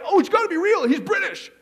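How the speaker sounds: high, loud put-on British accent, hammed up; mock British accent. Preacher mocking people fooled by a British-voiced demon, in mock British accent. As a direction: gush it out in a silly British accent. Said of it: "that incredible British accent going on".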